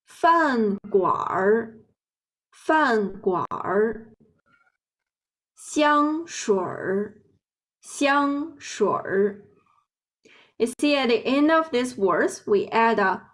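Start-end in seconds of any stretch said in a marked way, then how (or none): 0.1-4.1 饭馆儿 said slowly with a curled er ending; 饭馆儿, slow, ending on the er sound; erhua demo. Shown as a demonstration. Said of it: "we pronounce it like". 5.6-9.4 香水儿 slow and higher, with a curled er ending; 香水儿, slow, rolling the er onto the end; erhua demo. Shown as a demonstration. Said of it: "we pronounce it like".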